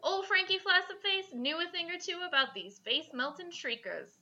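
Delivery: usual speaking voice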